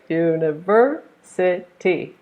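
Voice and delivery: slow u-ni-VER-si-ty, VER strongest; university syllable by syllable, stressing VER; third-syllable stress. Shown as a demonstration. Teacher counting syllables of university, slow, stressed on VER. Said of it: "Stress is on the third one".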